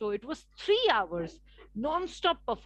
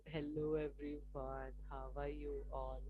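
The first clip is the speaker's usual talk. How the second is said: dull, unenthusiastic greeting; 9 semitones lower, 14 dB quieter than usual. Shown as a demonstration. Communication coach greeting a session the wrong way, dull and lifeless. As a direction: greet them with no energy at all. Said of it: "So I can talk like that also".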